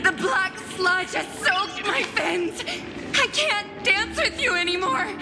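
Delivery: high, desperate cries to a companion; distressed cry. Acted. Wounded siren cries out to Electra.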